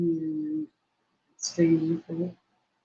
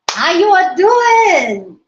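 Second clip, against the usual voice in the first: excited greeting; 8 semitones higher, slower than usual. Real, not acted. Excited greeting to stream viewers.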